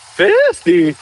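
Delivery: voice crack. Real, not acted. Streamer's voice cracks while reacting to likes. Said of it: "did you hear my seventh grade voice just come out".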